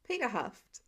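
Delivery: usual speaking voice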